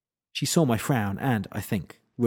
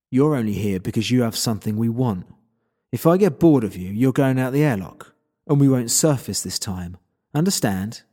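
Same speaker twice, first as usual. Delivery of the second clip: louder, forceful thug threatening a prisoner; menacing captor; 5 dB louder than usual. Acted. Narrator voices a threatening captor.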